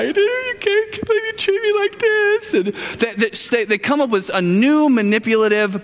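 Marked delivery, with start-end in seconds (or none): 0.0-2.5 high, slow, whiny mock complaint; hammed-up wounded spouse, voice climbing high; mock crying wife. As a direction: play the hurt wife, pitched way up and drawn out. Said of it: "For women, they cry".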